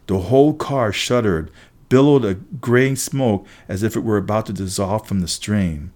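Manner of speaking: ordinary delivery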